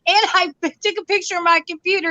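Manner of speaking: ordinary talk, nothing marked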